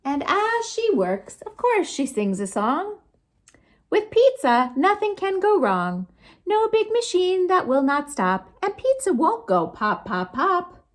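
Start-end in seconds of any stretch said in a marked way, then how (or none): none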